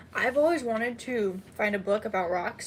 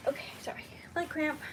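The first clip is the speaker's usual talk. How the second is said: whispered apology through pain; 4 semitones higher, 6 dB quieter, faster than usual. Real, not acted. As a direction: breathe it out, still hurting.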